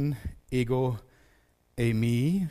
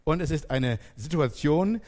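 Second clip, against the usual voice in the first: ordinary talk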